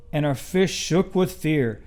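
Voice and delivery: plain reading voice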